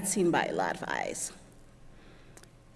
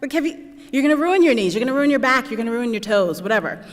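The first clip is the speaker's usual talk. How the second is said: exasperated retort; 5 semitones higher, 5 dB louder than usual. Retold or imitated. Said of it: "I'm like".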